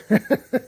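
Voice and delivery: brief laugh after a tender memory; short laugh. Real, not acted. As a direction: let a little laugh slip out.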